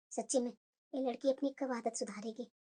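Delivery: mother sighing over her daughter, hushed; muttering to herself. Acted. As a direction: grumble quietly so nobody hears. Mother worries aloud to herself.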